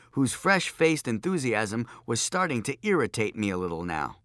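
plain reading voice

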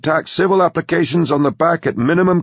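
put-on high, loud, punchy old businessman; brash old tycoon. Grandpa selling his company's project, brash and loud. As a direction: crow it out like a pushy magnate.

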